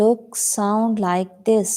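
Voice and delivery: drawn-out, careful phrasing; slow model reading. Shown as a demonstration. Teacher models the sentence slowly for the class. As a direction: say each word slowly to be copied. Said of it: "teacher models the sentence".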